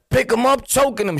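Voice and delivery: ordinary talk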